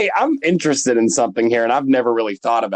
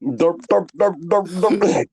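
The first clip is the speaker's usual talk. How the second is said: put-on drunken robot; 4 semitones higher than usual. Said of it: "You sound like a drunken robot".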